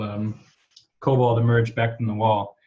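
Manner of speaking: normal talking voice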